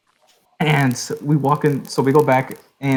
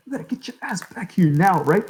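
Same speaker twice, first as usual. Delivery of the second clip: urgent whispered order, higher; a hushed, higher demand to come back now; hissed whisper; 6 semitones higher than usual. Retold or imitated. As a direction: whisper it like a furious chaperone. Anna orders him back urgently.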